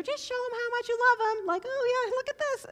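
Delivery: put-on high, bright kid voices; eager little girls praying. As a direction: play the girls praying with excitement. Fifth graders praying over each other.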